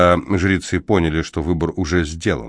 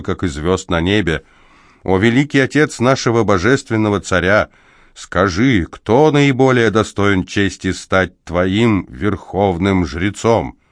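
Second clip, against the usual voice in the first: solemn invocation; 3 semitones higher than usual. Acted. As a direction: call on the god with ceremony. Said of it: "торжественно вопросил".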